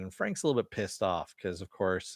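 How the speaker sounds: ordinary talk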